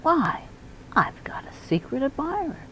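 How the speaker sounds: high, bursting with wonder; high excited whisper of a secret; delighted exclamation. Acted. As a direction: blurt out the happy discovery.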